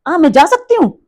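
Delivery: "जा सकती हूँ" lifted high as a question; questioning intonation. Shown as a demonstration. Teacher translates the example, "जा सकती हूँ" asked high. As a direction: ask it with the voice pitched well up on "सकती". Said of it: "that means question mark लग गया".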